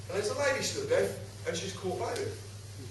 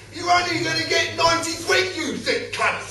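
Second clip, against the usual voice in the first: high, loud exasperated insult; getting riled; 9 semitones higher, 4 dB louder than usual. Retold or imitated. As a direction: build to a riled-up punchline, pitched high. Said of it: "I nearly started to get riled".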